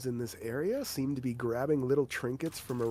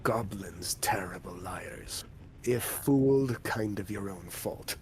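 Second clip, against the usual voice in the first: whispery hobgoblin; 5 semitones lower than usual. Acted. Hobgoblin warning the party about goblins, low whisper. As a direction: hush it like a sly creature.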